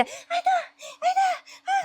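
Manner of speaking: imitated baby laughing hard. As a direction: cackle like a toddler seeing something delightful. Baby laughing loudly pointing.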